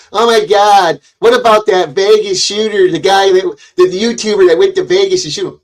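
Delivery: high-pitched mock outrage; hammed-up scandalized impression; mocking imitation. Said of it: "You say".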